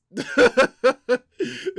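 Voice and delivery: high, loud burst of real laughter; helpless laughter. Real, not acted. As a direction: crack up loudly. Cracks up at nuts callback.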